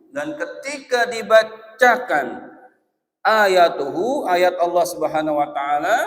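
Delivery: loud, high, drawn-out preaching; preaching at full force. Acted. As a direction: stretch each word to stir them. (ceramah lantang dan diperlambat)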